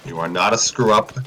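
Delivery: tender. Acted. Fred tells his son he loves him, low and tender. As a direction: tell him he is loved.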